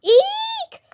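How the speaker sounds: a tiny high-pitched shriek of fright; cartoon scream from the whole group; high squeal. A narrator's reading. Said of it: "we all screamed".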